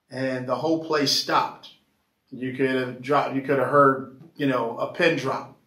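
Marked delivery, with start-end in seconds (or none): none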